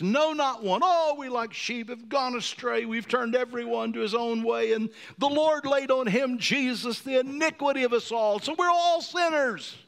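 loud, high preacher's cry; rising sermon at full force; full-force preaching. Acted. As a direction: preach it out, building. Preaching crescendo.

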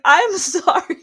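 high, loud apology through laughter; half-mock sorry, breaking into a laugh. Podcast host retelling apology to offended listener, laughing.

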